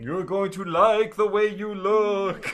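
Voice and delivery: slow, high ghostly mirror voice; haunt them from inside the mirror. Acted. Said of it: "hear a ghostly voice come out of one of the mirrors saying".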